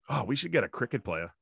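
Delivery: normal talking voice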